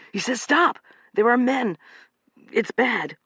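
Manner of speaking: high, alarmed and urgent; gasping. A narrator's reading. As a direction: gasp out the warning. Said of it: "Joe gasped".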